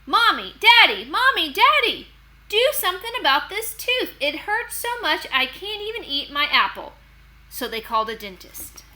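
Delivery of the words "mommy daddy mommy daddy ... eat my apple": high, loud whiny wail; wailing for parents. Acted. Boy begs his parents about the tooth, high wail. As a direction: cry out to mom and dad in pain.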